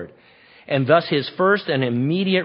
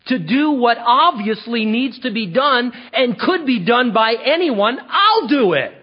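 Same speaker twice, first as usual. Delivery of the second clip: loud, much higher, slow outrage; indignant David; 9 semitones higher, 6 dB louder than usual. Shown as a demonstration. Young David to the soldiers, indignant and eager. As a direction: demand why nobody has gone yet. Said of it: "Here's how I hear him saying this".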